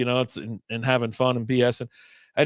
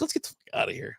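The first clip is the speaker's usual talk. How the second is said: whispered urgent getaway; sneaking out of the bar, whispering to his brother; whispered aside; 7 semitones lower, 9 dB quieter than usual. Retold or imitated. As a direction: hiss it under your breath to your brother. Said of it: "I was like".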